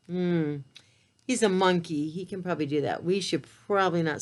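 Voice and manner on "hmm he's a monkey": usual speaking voice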